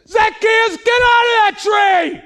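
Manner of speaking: piercing high yell at full volume; shouted line. Retold or imitated. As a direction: belt it out like a child on stage. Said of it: "That's how she heard it".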